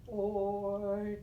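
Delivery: growly dinosaur or; dinosaur roar. Shown as a demonstration. Teacher voicing a dinosaur for the or sound. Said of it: "just like a dinosaur".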